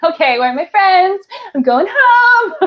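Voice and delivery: high, loud, rising escape cry; mock panic. Retelling her escape from a creepy man, loud mock panic. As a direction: call out for rescue, climbing to a wail.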